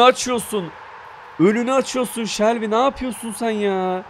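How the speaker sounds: high, incredulous telling-off; exasperated scolding. Real, not acted. Gamer scolding teammate Shelby for blocking the shot, exasperated. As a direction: scold him for getting in the way.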